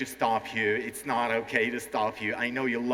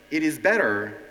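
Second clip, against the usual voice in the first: ordinary delivery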